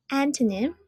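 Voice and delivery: AN-to-nym, first syllable strong; stress on the first syllable. Shown as a demonstration. Teacher modelling antonym for learners, stressing AN. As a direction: say antonym with the weight on AN. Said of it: "put the stress on the first one".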